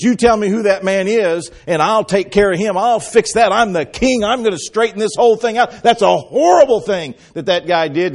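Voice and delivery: a furious king vowing to set things right; outraged king. Acted. Preacher voicing outraged King David, loud and high. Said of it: "King David is outraged".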